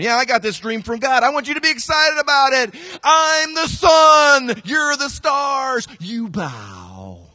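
high, loud, slow bragging proclamation; boastful Joseph. Retold or imitated. Joseph boasting to his brothers, loud and smug. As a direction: crow it like a smug dreamer. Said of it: "Joseph's going".

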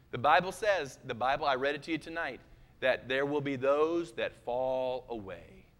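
ordinary talk, nothing marked

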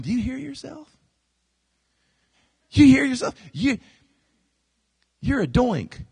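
high, incredulous scoffing; mocking brothers. Brothers mocking Joseph's boast, incredulous and scornful. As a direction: scoff at a bragging sibling. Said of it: "the brothers are going".

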